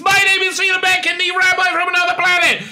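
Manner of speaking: loud high outro shout; announcer outro. Acted. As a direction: belt the sign-off cheerfully. Outro sign-off.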